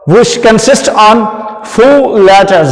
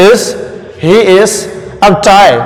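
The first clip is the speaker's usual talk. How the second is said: ordinary talk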